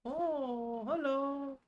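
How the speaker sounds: very high, soft coo; high singsong hello. Real, not acted. Cooing to a baby on camera.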